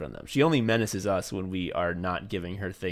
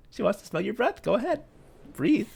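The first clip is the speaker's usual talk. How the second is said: high, sugary, encouraging voice; sweet coaxing encouragement; 12 semitones higher than usual. Retold or imitated. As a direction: coax them like a sweet host. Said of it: "smiling benignly".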